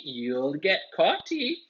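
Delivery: slow, teasing sing-song ghost voice; mischievous poltergeist rhyming taunt. A narrator's reading. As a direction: gloat it slowly like a naughty spirit. Peeves teasing the students.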